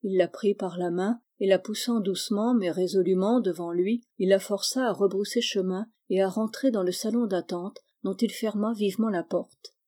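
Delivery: usual speaking voice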